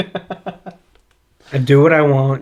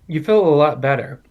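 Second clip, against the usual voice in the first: ordinary delivery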